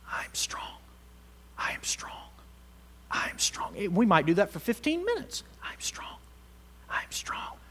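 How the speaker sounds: whispered "I'm strong" from a sick bed. Retold or imitated. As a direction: barely breathe the words like someone too weak to speak. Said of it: "by them just declaring".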